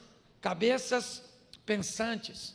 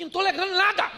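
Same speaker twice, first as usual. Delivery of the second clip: higher, louder hammed-up protest; mock protest shout; 8 semitones higher, 8 dB louder than usual. Husband protesting to his teasing wife, hammed-up shout. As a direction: throw up your hands and yell it playfully.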